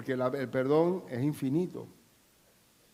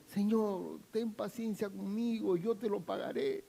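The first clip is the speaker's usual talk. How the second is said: pleading debtor; 5 semitones higher, 6 dB quieter than usual. Acted. Debtor begging his lord.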